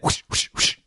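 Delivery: breathy whoosh noises; punching whooshes. Acted. Host miming kung fu punches, with whispered whooshes. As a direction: blow out punch sound effects. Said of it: "That was me punching".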